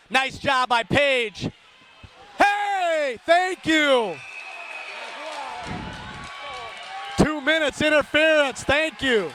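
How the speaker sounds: brisk live game call; radio hockey announcer calling the puck; hockey play-by-play. Acted. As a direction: call the action as it happens.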